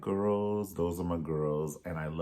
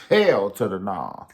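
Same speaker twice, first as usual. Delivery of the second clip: mock loud refusal; 10 dB louder than usual.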